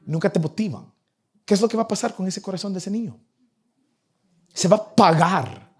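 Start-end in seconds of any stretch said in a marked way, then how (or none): none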